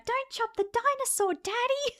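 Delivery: put-on very high, pleading little-kid voice; child in advert. Child in a remembered sweets ad pleading with Daddy, high mock kid voice. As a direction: imitate a squeaky child begging Daddy. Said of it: "but also the ad".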